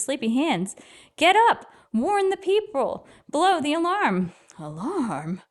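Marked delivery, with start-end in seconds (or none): 1.2-4.3 high, urgent call to rouse a sleeper; urgent wake-up shout. Acted. Boy rousing the sleeping trumpeter. 4.6-5.4 sleepy yawning question, much softer and lower; half-awake, through a yawn. A narrator's reading. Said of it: "yawned the trumpeter".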